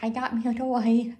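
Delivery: a cat bragging about her new toy; proud cat voice. Retold or imitated. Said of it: "And Gigi's like".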